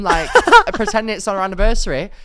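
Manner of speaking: ordinary talk, nothing marked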